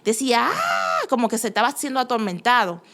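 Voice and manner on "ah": piercing high squeal of a tormented demon; sudden high-pitched demonic screech; demon shriek. Retold or imitated. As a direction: shriek it out like something being burned. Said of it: "él chillaba".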